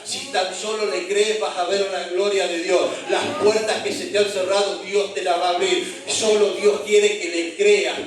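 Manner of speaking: loud, driving Argentine preacher exhorting the church; fervent preaching. Acted. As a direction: press the promise on the congregation.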